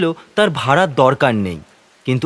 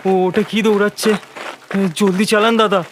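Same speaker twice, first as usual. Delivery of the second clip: higher, strained plea in terror; panicked cry; 5 semitones higher than usual. Acted. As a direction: urge the driver on in choked fear. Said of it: "মানুষের স্বর বেরোচ্ছে না, যেন কোনো পশুর গোঙানি". (আতঙ্কে রুদ্ধ গলায় আর্তনাদ)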